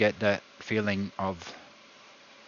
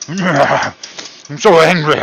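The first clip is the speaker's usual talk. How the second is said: slow, higher, very loud mock roar of anger; hammed-up fury; 7 semitones higher, 18 dB louder, much slower than usual. Cook over a lost fry, hammed-up slow furious roar. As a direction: stretch out a huge cartoonish yell. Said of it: "it makes me so angry".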